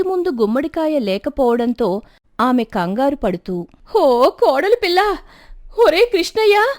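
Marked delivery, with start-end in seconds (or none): 3.9-6.7 high, loud panicked calls to the family; calling out loudly. A narrator's reading. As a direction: shout for everyone to come, in a panic. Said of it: "పెద్ద పెద్దగా కేకలు వేయడంతో".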